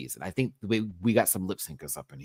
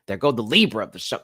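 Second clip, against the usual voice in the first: mock dismay; 4 semitones higher, 7 dB louder than usual. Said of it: "I was like".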